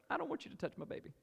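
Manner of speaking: high, fast mock mom voice; a mother shielding her newborn from strangers, squeaky and quick; protective mom. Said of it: "You're like".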